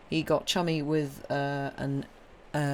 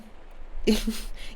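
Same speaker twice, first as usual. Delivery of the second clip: breathy laugh; 7 semitones higher than usual. Real, not acted. Laughs at own remark.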